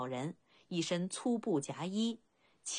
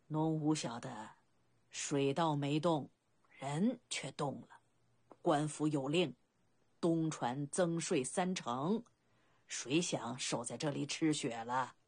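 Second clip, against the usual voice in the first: put-on low, unhurried elderly villager; amused old boatman; 4 semitones lower than usual. Old boatman's dialect dialogue. (低缓的老船夫乡音，略带笑意)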